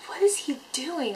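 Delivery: high-pitched repeated question; blurted out loud. Retold or imitated. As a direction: reenact an incredulous outburst. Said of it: "Like, out loud".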